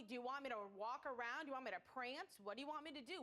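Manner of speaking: ordinary delivery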